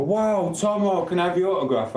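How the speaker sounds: high, gushing fan voice asking for an autograph; starstruck fan. Pretend. Streamer plays a fan spotting him in Barnsley, gushing and high. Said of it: "you can just run up and go".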